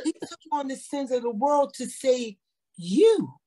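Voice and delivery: testifying to friends about her rebuke, fervent and rising; fervent testimony. Real, not acted. Preaching the Spirit's rebuke.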